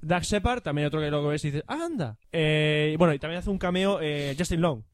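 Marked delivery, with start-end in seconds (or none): 1.6-2.2 higher, soft surprised "Anda"; a quiet little gasp of recognition; mock surprise. Reconocer al actor. ("Anda" sorprendido, agudo y suave)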